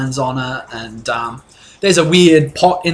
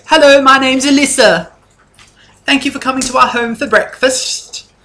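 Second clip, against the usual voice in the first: much higher, louder, sing-song hostess voice; wife Alyssa; 11 semitones higher, 5 dB louder than usual. Acted. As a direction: gush like a bubbly hostess, pitched way up. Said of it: "She goes".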